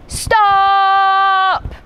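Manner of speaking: high, long-held yell; shout. A narrator's reading. Izzy halts the zoo chaos, shouting. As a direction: holler to halt everyone. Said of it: "shouted Izzy at the top of her voice".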